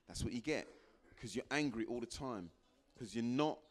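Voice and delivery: normal talking voice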